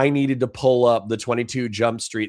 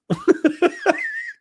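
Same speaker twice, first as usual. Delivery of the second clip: high laugh. Real, not acted. Laughing at the bit.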